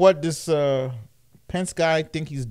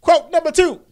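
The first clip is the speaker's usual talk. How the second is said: announcer bark; 13 semitones higher, 8 dB louder than usual. Acted. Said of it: "announcing quotes in mock voice".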